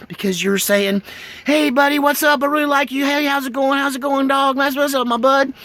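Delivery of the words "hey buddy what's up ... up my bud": much higher, louder, eager greeting; excited dog talk. Retold or imitated. Dog greeting a friendly human, excited and high. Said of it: "In dog language".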